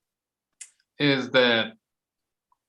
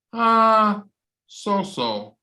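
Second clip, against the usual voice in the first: meh answer; 6 semitones higher, much slower than usual. Shown as a demonstration. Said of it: "Anybody can say".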